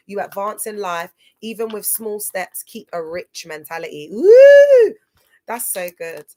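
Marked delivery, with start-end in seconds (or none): 4.1-4.9 whoop. Real, not acted. Cheering a viewer's comment.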